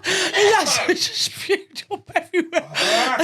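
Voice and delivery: slow, high, words squeezed out through laughter; barely able to speak for laughing; laughing helplessly. Real, not acted. Laughing at the friend.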